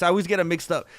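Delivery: normal talking voice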